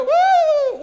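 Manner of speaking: loud, very high sustained woo; howling wind woo. Shown as a demonstration. Host showing audience the wind sound, high loud woo. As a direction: imitate wind with a high hoot. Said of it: "you go".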